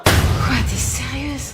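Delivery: loud disbelieving cry; can't believe it; shocked. Acted. Shocked at friend skipping.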